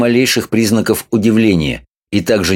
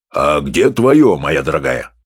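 professor's voice; 6 semitones lower, slower than usual. A narrator's reading. Professor retorts to wife.

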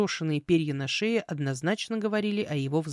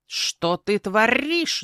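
loud, very drawn-out croak rising high; outraged raven; 5 semitones higher, 6 dB louder, much slower than usual. Acted. Raven bursting in on the girl, outraged croak. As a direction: demand it with rolling r, climbing.

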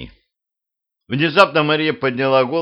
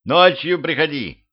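higher, moderately raised call; mild narrated yell; 6 semitones higher than usual. A narrator's reading. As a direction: call it out to send a visitor off. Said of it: "заорал я".

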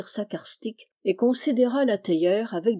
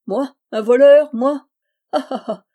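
sarcastic outrage; 5 semitones higher, 9 dB louder than usual. A narrator's reading. Yann's sarcastic laugh recalled.

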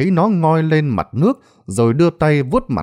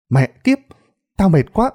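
speaking up loudly; 6 semitones higher, 3 dB louder than usual. A narrator's reading. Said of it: "thằng Bâu lên tiếng nói lớn".

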